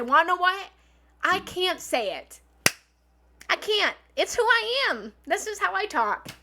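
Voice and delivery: loud, high, defiant declaration; unapologetically owning it. Acted. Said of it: "own that shit and say".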